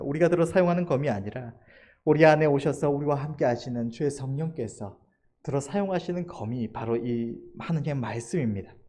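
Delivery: ordinary talk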